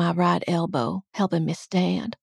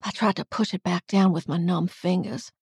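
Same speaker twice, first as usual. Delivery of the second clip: ordinary delivery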